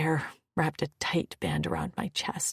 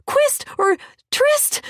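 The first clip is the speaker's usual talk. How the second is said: high pleading; 10 dB louder than usual. Acted.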